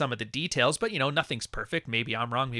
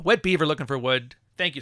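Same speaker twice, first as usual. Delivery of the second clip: normal talking voice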